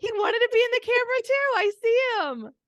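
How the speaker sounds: very high, loud delighted squeal; real high-pitched gushing over a pet; excited squeal. Real, not acted. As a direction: squeal with delight at spotting the dog. Spots the dog on camera.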